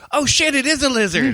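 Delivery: high-pitched startled exclamation; retold shock. Storyteller reliving spotting a lizard on Bob, startled high cry. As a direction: yelp it in alarmed surprise.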